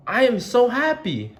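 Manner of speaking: high, loud happy pig voice; happy Piggy. Acted. Piggy telling Gerald she is happy, high and bright. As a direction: bubble over with joy.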